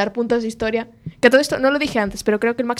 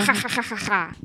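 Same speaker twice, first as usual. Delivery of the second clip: quiet mock mad-scientist cackle; half-hearted villain laugh; evil laugh; 4 semitones lower, 6 dB quieter than usual. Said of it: "el nombre de la habilidad es".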